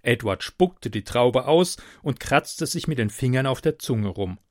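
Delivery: usual speaking voice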